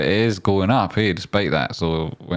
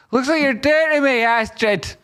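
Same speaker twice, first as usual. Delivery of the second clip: squeaky put-on whine, much higher; high mock whine. Complaining to Astrid about the stress, high whiny voice. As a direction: whimper it up high like a wronged pet.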